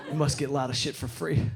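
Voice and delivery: ordinary talk, nothing marked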